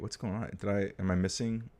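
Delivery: ordinary talk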